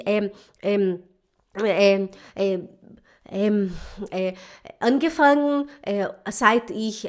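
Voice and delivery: slow, stammering German full of drawn-out fillers; imitating halting German. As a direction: mimic a struggling learner groping for each word. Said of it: "e fica gaguejando". (imitando alemão gaguejado)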